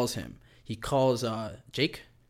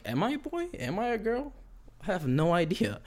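imitating a puzzled kid, higher and softer; a small, higher voice unsure of itself; confused kid; 7 semitones higher, 4 dB quieter than usual. Confused kid asks its gender.

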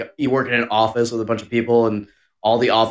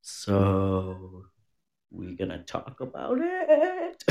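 hushed teasing question; 4 semitones lower, 10 dB quieter, much slower than usual. Retold or imitated. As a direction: draw it out softly and sly. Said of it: "I'm usually like".

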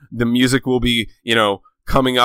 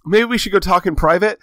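nervous Bernard; 7 semitones higher than usual. Retold or imitated. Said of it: "Bernard is like".

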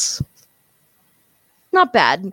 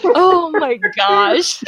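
laughing; 7 semitones higher, much slower than usual. Real, not acted. Laughing after fireball joke.

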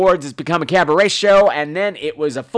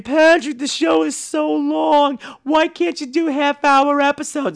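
mock whiny listener; 10 semitones higher, slower than usual. Listener letter complaining the show runs long, mock whine. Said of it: "letters and stuff saying".

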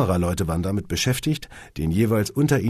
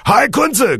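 high, loud bawled greeting; bawling; 9 dB louder than usual. A narrator's reading. Steven greeting Kunze from behind the lady, bawling. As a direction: bellow a hearty hello. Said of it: "gröhlte Steven".

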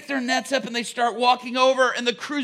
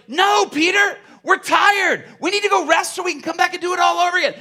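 loud, fast, higher exasperated shout; tired crew yelling; 7 semitones higher, 5 dB louder than usual. Acted. Weary fishermen shouting no at Peter, loud and fast. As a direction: yell back like worn-out fishermen refusing.

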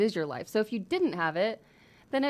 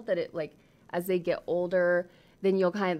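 ordinary talk, nothing marked

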